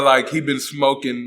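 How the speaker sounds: ordinary talk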